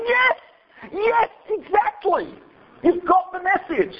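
high, slow, overjoyed yes; mock a delighted missionary; eager caroler. Carolers' eager answer, mimicked.

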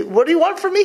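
high, loud, fed-up "what do you want from me"; exasperated retort. Retold or imitated. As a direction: throw it back at her, fed up. Yaakov's retort retold.

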